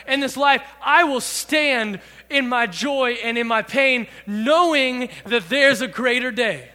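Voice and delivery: loud, emphatic preaching. Acted. Pastor preaching the lyric to the youth group, loud. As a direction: declare each line like a promise.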